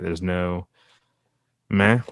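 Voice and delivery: ordinary talk, nothing marked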